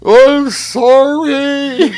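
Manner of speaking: high, slow, loud mock wail; imitating crying drunk. Drunk man apologising to the rider on horseback, mock sobbing high and loud. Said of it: "he starts crying".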